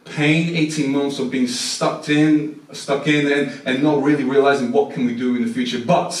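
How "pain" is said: normal talking voice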